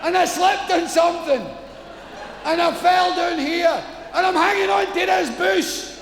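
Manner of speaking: high shouted explanation; frantic shout. Acted. Stranded man shouting up to God, frantic. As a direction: yell up pleading your case.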